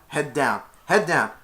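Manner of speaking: loud, high repeated commands ending in a question; mock drill sergeant; drill instructions. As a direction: chant orders then balk. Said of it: "and they went".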